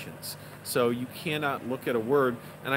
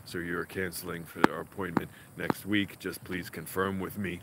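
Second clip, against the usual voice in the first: low, muted imitation of a sour tutor; pissed-off, constipated tutor; 8 semitones lower, 5 dB quieter than usual. Tutor leaving a voicemail after a cancelled lesson, low and sour. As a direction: sound like a joyless tutor, voice dropped deep. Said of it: "you'd think you'd sound a little happier than a guy who's, like, pissed off and constipated".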